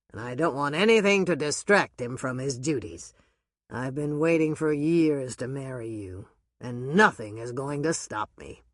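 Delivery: firm and loving declaration; a lightly voiced low male voice, determined; Doc, devoted. A narrator's reading.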